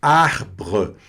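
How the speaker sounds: arbre, both R's scraped in the throat; French throat R. Shown as a demonstration. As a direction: arbre with a rasping guttural R. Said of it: "os dois R's, né, foram na garganta". (arbre com os dois R na garganta)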